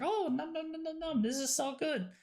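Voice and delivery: a touch of gleeful yum voice; mock tasting. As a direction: play a delighted taster. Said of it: "and then it's like".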